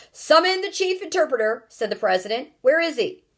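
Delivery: panicky shout. A narrator's reading. President shouting orders.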